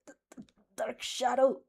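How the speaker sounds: stammered, squeezed call for help; choked out. A narrator's reading. Tokoyami, strangled by his own quirk, chokes out a stammered call. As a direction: gasp it out while being strangled. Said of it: "Tokoyami chokes out".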